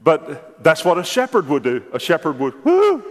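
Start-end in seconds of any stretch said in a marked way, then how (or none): 2.6-3.0 high, loud whoop; shepherd's call. Shown as a demonstration. Shepherd calling his sheep across the road, loud high whoop. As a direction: call the flock to you. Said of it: "He'd make his sound".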